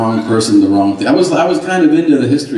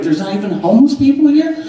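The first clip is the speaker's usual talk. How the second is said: mock amazed tourist; 14 semitones higher than usual.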